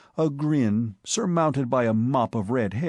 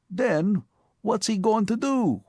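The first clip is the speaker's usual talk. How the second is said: put-on higher, tough New York street kid; rough Bowery boy, eager and quick; Bowery accent; 10 semitones higher than usual. A narrator's reading. Spike's voice.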